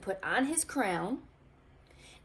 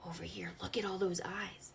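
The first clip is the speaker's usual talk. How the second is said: whisper; 8 dB quieter, faster than usual. Acted. Aside pointing at the picture.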